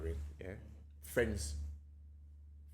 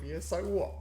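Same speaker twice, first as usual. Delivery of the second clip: defiant thought; 8 semitones higher than usual. Retold or imitated. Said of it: "I'm thinking to myself".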